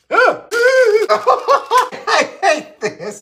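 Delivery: squealed through laughter, very high and drawn out; cracking up at the gross lard; laughing. Real, not acted. Laughing at lard on her hands, squealing it out.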